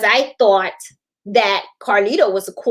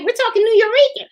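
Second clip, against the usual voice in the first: playful retort; 11 semitones higher, faster than usual. Retold or imitated. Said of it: "I'm like".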